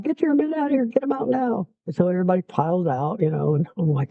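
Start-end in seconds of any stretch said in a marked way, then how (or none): 0.0-1.7 high-pitched, pressing command, not shouted; urgent retold order. Airman ordering captain to evacuate his men, urgent retold order. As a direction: act out an emergency order at normal volume.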